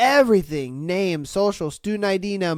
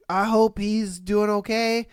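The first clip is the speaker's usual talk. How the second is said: ordinary talk, nothing marked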